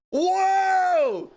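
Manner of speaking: high, loud amazed whoa; excited whoa. Real, not acted. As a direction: shout it in amazement. Reacting to knockdown.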